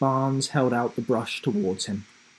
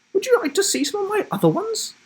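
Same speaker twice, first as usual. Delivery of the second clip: much higher, quick and teasing; mischievous grandma; 4 dB louder, faster than usual. Acted. Grandma teases the teacher, high quick playful voice. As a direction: offer it with a twinkle.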